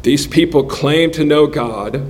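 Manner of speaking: normal talking voice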